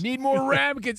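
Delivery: high, urgent order-calling voice; mock kitchen call. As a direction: call out like a swamped restaurant worker. Re-enacts busy restaurant kitchen call.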